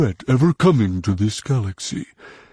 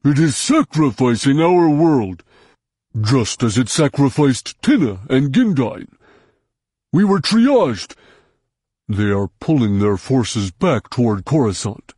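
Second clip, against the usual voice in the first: angry Hutt tirade; 3 semitones higher than usual. A narrator's reading. Hutt raging at Jason about the New Republic. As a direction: rage at the betrayal.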